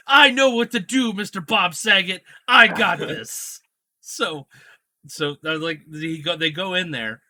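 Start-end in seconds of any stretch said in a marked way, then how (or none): 0.1-3.6 loud, high, gleeful plotting; scheming Brad. Retold or imitated. Brad telling Bob Saget he has a plan, loud and gleeful. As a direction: announce the prank with glee. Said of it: "Brad Williams is like".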